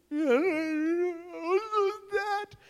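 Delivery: long, high, wailing whine; whiny teenager. Acted. Preacher mocking Jonah as a teenager, drawn-out wail. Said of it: "Sounds like a teenager".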